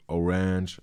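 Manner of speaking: orange dragged toward "o-RANGE", stressed on the end; altered pronunciation. Shown as a demonstration. Showing how English bends for rhymes, orange pronounced differently. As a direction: say orange stretched out, second syllable stressed. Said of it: "pronounce it in a different way".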